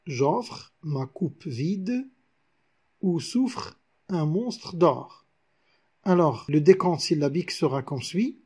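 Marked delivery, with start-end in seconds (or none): 0.1-5.3 very slow, deliberate recitation of the verse; slow verse recital. Shown as a demonstration. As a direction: read the line at half speed, savouring it. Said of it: "regardons ce vers".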